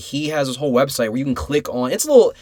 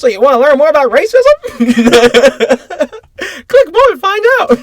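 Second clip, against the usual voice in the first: much higher, louder cartoon helper pitch with laughs; a hammed-up eager pop-up assistant; chirpy paperclip voice; 13 semitones higher, 10 dB louder than usual. Mocking the website's paperclip helper, high, loud, cartoonish.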